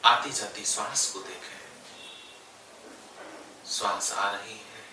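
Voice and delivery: unhurried yoga nidra guidance with long pauses; guided meditation. Real, not acted. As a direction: guide them gently into stillness, phrase by phrase. Leads yoga nidra relaxation. (ठहर-ठहर कर योग निद्रा का निर्देश)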